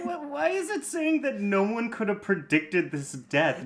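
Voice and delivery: ordinary talk, nothing marked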